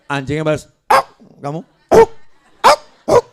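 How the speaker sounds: dog barking. Acted. Preacher imitating a dog, loud barking. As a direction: bark like a dog.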